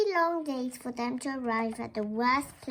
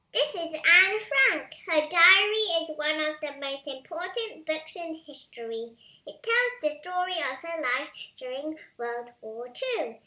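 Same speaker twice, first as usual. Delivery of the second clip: put-on higher, louder announcer-style pitch to kids; upbeat intro read; 5 semitones higher, 5 dB louder than usual. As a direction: sell it like a kids' channel host. Bright channel-intro read to kids.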